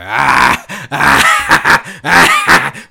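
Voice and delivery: very high, loud mock cackle; exaggerated hearty laughing fit; hysterical laughing. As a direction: shriek out an imitation belly laugh. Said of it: "the way she was laughing".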